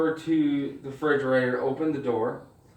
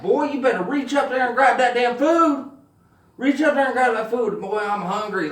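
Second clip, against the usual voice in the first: high, loud voice of a hungry stomach; demanding stomach; 9 semitones higher, 4 dB louder than usual. Acted. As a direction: holler the stomach's orders. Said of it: "my stomach was like".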